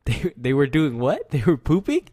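high, loud incredulous question; can't believe what you heard; shocked disbelief. Real, not acted. Disbelief at hearing pooping.

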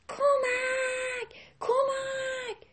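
high, drawn-out shout from afar; desperate cry for help. Acted. Rabbit shouting for help across the river. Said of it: "میگفت کمک".

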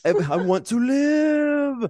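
slow, high, loud drawn-out wail; hammed-up desperate plea for life; Frankenstein's monster.

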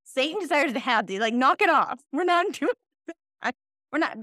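high, loud playful telling-off; exasperated mom, played for laughs; mock scolding parent. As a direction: scold the kids, half laughing. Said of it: "I'm like".

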